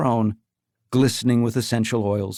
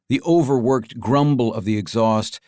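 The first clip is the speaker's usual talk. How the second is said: usual speaking voice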